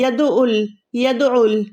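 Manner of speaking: यदउल run together with no held ain; यदउल glided through without a throat stop; people's mistake, undoubled. Retold or imitated. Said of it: "ऐसे पढ़ते हैं लोग".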